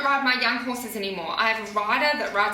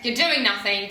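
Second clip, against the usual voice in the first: ordinary delivery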